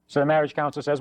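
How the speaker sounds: normal talking voice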